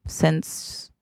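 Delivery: whispered since, barely voiced. Hinting at his dead wife, whispered since. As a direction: breathe the word since.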